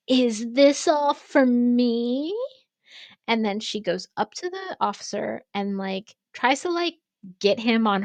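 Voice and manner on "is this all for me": loud, drawn-out mock question, pitched up; hammed-up imitation of a difficult customer; mimicking the customer. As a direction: stretch it out high and loud, imitating her. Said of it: "she's like".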